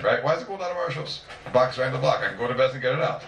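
ordinary talk, nothing marked

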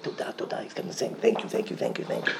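whispered, repeated thanks. Retold or imitated. Father repeating thanks to God, whispered. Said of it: "He said".

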